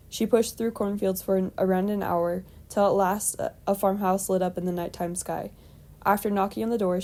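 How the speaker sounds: ordinary delivery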